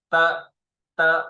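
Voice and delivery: "the" as "de", short and up. Shown as a demonstration. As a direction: say "the" like a brief high "duh". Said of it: "we will go up and short".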